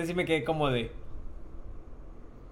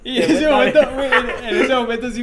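laughs through his words, high and loud; laughing as he tells it; 8 semitones higher, 11 dB louder than usual. Real, not acted. Laughs while retelling his fright.

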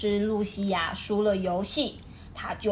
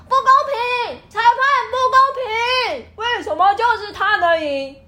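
high, loud whining tantrum; sore loser whine; 11 semitones higher, 6 dB louder than usual. Retold or imitated. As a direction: wail like a kid who lost. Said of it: "运动家会不会躺在地板上就说". (高声哭闹撒泼)

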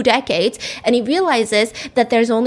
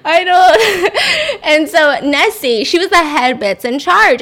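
words squeezed out through laughter, higher and louder; laughing; 6 semitones higher, 5 dB louder, slower than usual. Real, not acted. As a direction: still giggling while trying to go on. Laughing fit while talking.

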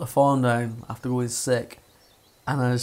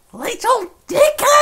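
much higher, shrieking mum; hammed-up angry yell; 1 mock furious shout; 5 dB louder than usual. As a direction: scream it like an outraged mother. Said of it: "she went".

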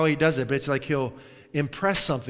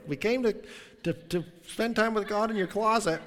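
high, halting mock-visitor voice; imagined eager visitors; 7 semitones higher than usual. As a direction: imitate callers piping up at the door. Voices imagined visitors at his door.

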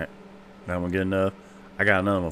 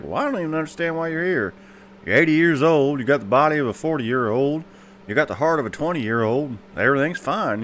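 doctor voiced higher, puzzled; 6 semitones higher than usual. Doctor telling the old man he is fine, higher and puzzled. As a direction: wonder why he even came in, pitched up.

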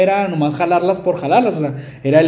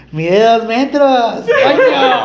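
high, loud, laughing retelling; a laughing imitation of Plati's outburst; laughing mock exclamation; 6 semitones higher, 4 dB louder, much slower than usual. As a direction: blurt it out through laughter. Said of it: "la Plati fue así de".

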